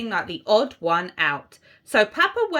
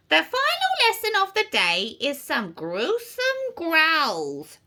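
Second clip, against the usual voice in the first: echoing witch teacher; 4 semitones higher than usual. A narrator's reading. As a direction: let it ring round a dungeon. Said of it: "her voice echoing around".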